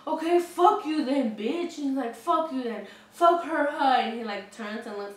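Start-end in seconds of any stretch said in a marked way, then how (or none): 0.1-1.8 loud, higher, drawn-out retold outburst; imitated anger. Drunk man cursing the woman who ignored him, imitated anger. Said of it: "And then he gets mad". 2.1-4.0 retold outburst building louder and higher; imitated anger. Spurned drunk turning to the next guy, imitated anger. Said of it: "And then he gets mad".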